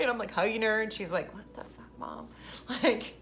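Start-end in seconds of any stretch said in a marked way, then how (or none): 1.3-2.3 hushed, voiceless mock disbelief; low whispered aside to mom; imitated muttered disbelief. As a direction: mouth it under the breath as an embarrassed kid. Daughter's imagined embarrassed reaction to mom.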